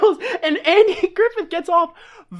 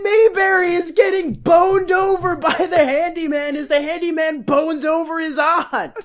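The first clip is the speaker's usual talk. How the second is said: gleeful public announcement; 3 semitones higher than usual. Acted. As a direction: shout the scandal to everyone, laughing. Sheriff announces gossip to the town.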